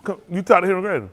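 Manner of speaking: ordinary delivery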